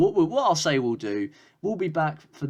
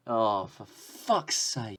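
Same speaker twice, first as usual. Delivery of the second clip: exasperated groan; much slower than usual. Real, not acted. Chance wasted.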